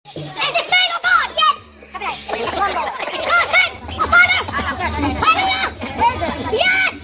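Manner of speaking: high-pitched revival shouting; fervent preacher cry. Acted. Fervent church-style shouting.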